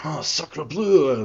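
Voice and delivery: slow, drawn-out French exclamation; French accent. Acted. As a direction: sacre bleu in a hammy French accent. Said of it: "I think he will be like".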